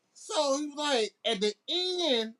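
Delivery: ordinary delivery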